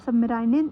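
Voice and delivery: "submarine" tried uncertainly with an eye-sound ending, "-rai-nen"; unsure pronunciation attempt. Real, not acted. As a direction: stumble on "submarine", ending it "-rainen". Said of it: "I don't know how to pronounce it". ("submarine" का अटकता उच्चारण, "-राइनेन")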